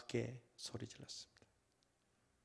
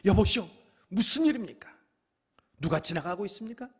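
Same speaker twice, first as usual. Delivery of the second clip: higher, louder plea of a blind beggar; blind man calling; 8 semitones higher, 9 dB louder than usual. Acted. Bartimaeus asking the crowd what is happening, loud and high. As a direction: call out to passing strangers. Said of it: "그리고 묻습니다".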